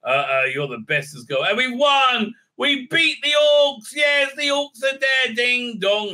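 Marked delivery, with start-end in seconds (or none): 1.4-6.1 mock victory cheer. Voicing victorious heroes.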